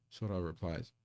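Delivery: usual speaking voice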